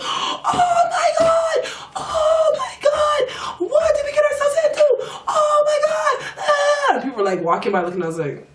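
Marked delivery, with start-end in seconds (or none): 0.0-6.9 very high, very slow, shrieking half-crying, half-laughing; hysterical panic. Retold or imitated. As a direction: scream it out in hysterical disbelief. Escaped, hysterical on the street.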